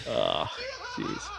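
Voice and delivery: ordinary talk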